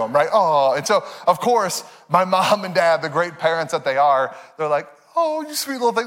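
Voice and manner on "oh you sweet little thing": lightly voiced doting mom; tender doting parent. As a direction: coo at a toddler you adore. Parents touched by toddler's gift.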